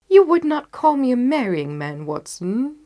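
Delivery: amused, bright Holmes voice; Holmes teasing. Acted. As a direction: tease your old friend with a grin in the voice.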